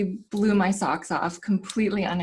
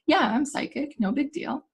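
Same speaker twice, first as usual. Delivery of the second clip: matter-of-fact; 7 semitones higher than usual. Retold or imitated. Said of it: "unapologetically and matter-of-factly been like".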